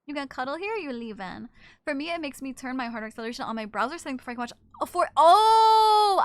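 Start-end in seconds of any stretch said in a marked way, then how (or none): 4.8-6.2 a sudden loud high "oh" of realization; startled outburst. Real, not acted. Realizing she missed a chat message, a loud startled outburst. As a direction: blurt it out as it hits you.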